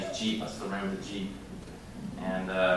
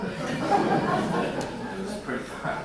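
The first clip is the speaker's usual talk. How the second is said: laughing; 11 semitones higher, much slower than usual. Real, not acted. Laughs recalling the cliff jump.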